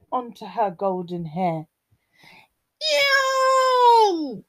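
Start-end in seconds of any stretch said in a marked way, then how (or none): 2.9-4.4 long, very high disgusted yelp; high squealed cry of disgust. A narrator's reading. Goldilocks gets porridge in her hair, high yelp. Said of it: "yelped Goldilocks".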